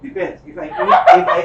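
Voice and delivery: laughing through the words; chuckling while answering a joke question. Real, not acted. Joking answer about burger pronunciation.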